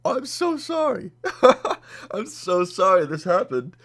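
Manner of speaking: very high, slow, squeaky held-in laughter; about to die laughing. Retold or imitated. Customer apologizing to the clerk while about to die laughing. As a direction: imitate an apology breaking into giggles. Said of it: "I'm about to die laughing".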